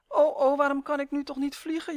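high, loud plaintive whine; wailing. A narrator's reading. Elf struggling along on short legs, wailing. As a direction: lament it in a high wail. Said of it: "jammerde Uf".

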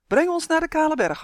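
high, loud call to the mount. A narrator's reading. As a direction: call out an urgent request. Justin calls command to mount. (luid roepend verzoek)

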